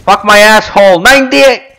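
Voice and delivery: loud angry outburst at the game; frustrated yelling. Real, not acted. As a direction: shout it in frustration. Frustrated gamer yelling at round.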